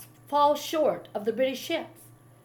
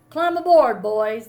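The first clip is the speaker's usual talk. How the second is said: shouting; 11 dB louder than usual. A narrator's reading. British naval officer ordering the boys aboard, loud shout. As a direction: command them aboard at full voice. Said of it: "Navy officer shouted".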